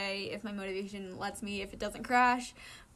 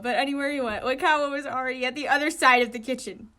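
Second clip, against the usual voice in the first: usual speaking voice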